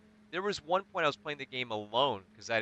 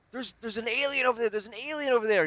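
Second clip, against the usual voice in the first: higher and louder, frantic; panicked warning; 11 semitones higher, 6 dB louder than usual. Retold or imitated. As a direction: blurt it out in a panic. Panicking to his buddy.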